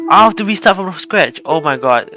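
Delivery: exasperated outburst. Real, not acted. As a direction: burst out in dismay at having to start over. Has to restart the song from scratch.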